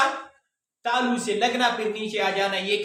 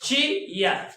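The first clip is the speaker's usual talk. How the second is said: Chair split as chee, pause, ya; two separate beats in chair; teacher sounding out both halves; syllable by syllable; 2 semitones higher, much slower than usual. Shown as a demonstration. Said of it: "दोबारा जो इसका हिस्सा है उसे पढ़ने".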